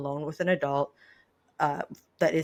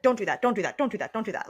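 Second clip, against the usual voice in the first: nagging parent; 2 semitones higher, faster than usual. Retold or imitated. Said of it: "not always being like".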